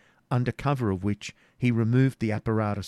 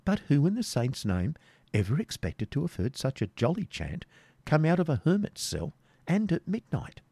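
jester voice; 6 semitones higher than usual. Acted. Wamba's jester voice.

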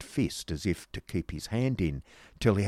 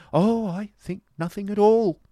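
high, loud, peevish comeback; huffy and dismissive, pitched right up; pettish retort; 14 semitones higher, 9 dB louder than usual. Acted. Miss Price retorts to Nicholas, pettishly. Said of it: "retorted Miss Price pettis-, pettishly".

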